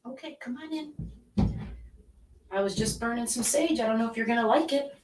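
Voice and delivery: high, singsong coaxing from across the room; pet talk. Real, not acted. Calling her pet in at door.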